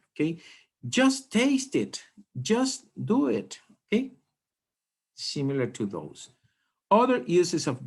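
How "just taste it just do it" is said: higher and slower, each "just" stressed like an order; commanding "just". Shown as a demonstration. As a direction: give it as a firm little command, hitting "just". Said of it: "at the beginning of a sentence when it is just a, a command".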